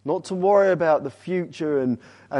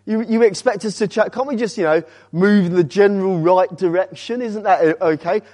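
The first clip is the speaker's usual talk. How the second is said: higher, incredulous pushback at Paul; protesting objector; 4 semitones higher, 4 dB louder than usual. Acted. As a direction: plead for a little slack, pitched up. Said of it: "It's like, what".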